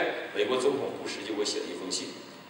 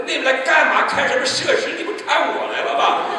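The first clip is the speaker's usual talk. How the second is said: higher, louder, half-laughing mock scolding; mock indignant retort; 10 dB louder than usual. To friends chatting outside his hospital room, a loud mock scolding. Mocking visiting friends retold.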